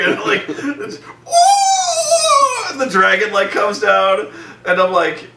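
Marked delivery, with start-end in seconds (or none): 1.0-2.7 high, loud laughing ooh; mock ooh. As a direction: squeal it out through laughter. Acting out dragon scene.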